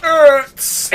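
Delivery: high choked squeak; strangled squeak. Retold or imitated. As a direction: squeak as if drained dry. Victim being drained.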